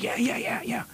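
high, whispered yeses; eager kid whisper. Retold or imitated. Kid hurriedly agreeing he knows the place, whispered and high. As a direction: agree eagerly under your breath. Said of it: "He says".

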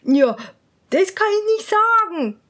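hesitate, too ashamed to say it; embarrassed lady-in-waiting. Acted. Lady-in-waiting shies from the answer, high and coy. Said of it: "antwortete die Hofdame".

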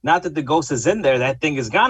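usual speaking voice

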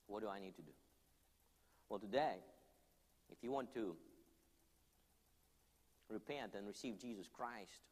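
earnest preacher leading prayer over soft music; altar call. Acted. Preacher urging the crowd to come forward, earnest and pleading. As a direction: plead gently with the congregation to respond.

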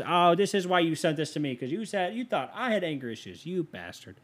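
high-pitched mock accusation, without shouting; mock indignation. Host accusing whoever sent him the letter, mock indignant. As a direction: play wounded outrage, voice lifted high.

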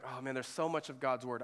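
ordinary delivery

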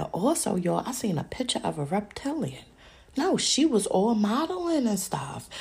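ordinary talk

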